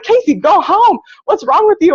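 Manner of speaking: mock the coworkers shooing her off; worried coworkers. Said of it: "And they were like".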